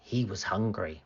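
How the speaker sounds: plain reading voice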